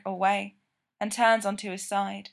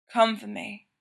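ordinary delivery

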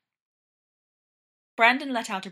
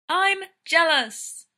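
slow, higher, louder call; 8 semitones higher, 7 dB louder, much slower than usual. A narrator's reading. Brendan to John heading past to the door, calling out uselessly. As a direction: draw it out, calling after someone. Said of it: "Brendan called out uselessly from behind him".

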